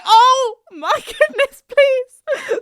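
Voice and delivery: high, slow, laughing cry of embarrassment; laugh-cry in mock mortification; mortified laughing. To Grace's manager after a blunder, laughing mortified.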